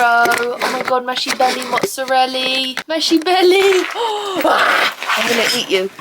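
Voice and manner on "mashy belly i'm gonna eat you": high gasping pet voice. Real, not acted. Cooing at her dog's belly. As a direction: coo and gasp over the dog.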